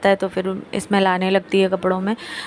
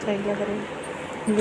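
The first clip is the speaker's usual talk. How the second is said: much softer, slow murmur to herself; 10 dB quieter, much slower than usual. Real, not acted. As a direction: murmur it under the breath. Quiet murmur while trying bangles.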